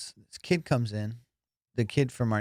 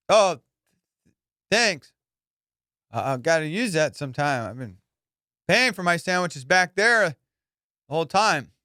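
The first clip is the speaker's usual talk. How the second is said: high, loud, halting thanks; awkward, overdone gratitude with gaps; guilty cover-up; 6 semitones higher, 4 dB louder than usual. Retold or imitated. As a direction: act pleased while caught out. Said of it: "my voice, guilty".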